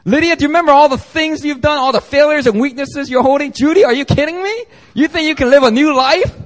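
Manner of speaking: high, loud mocking accuser; Satan accusing. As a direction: sneer at her failures in a shrill-pitched taunt. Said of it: "Satan accuses you and says".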